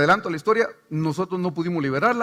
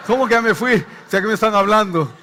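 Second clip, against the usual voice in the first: louder, laughing retort; laughing mockery; 3 semitones higher, 7 dB louder than usual. Real, not acted. Pastor mocking the demon's claim: loud laughing retort.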